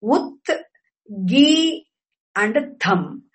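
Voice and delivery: "Ut, gi, and tham" as separate syllables. Shown as a demonstration. Teacher repeating the word's three parts, syllable by syllable. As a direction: pause after "Ut" and "gi", then drop onto "tham". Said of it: "are the three syllables".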